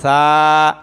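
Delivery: সা held low and clear; model letter. Shown as a demonstration. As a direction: lead repetition of সা. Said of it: "আবার বলেন". (সা স্পষ্ট করে বলা)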